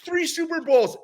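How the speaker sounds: high-pitched shout to a whole store; retold yelling. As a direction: bellow the introduction so the crowd hears, imitating him. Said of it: "he starts yelling".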